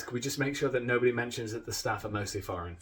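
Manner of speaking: usual speaking voice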